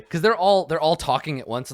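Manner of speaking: ordinary talk, nothing marked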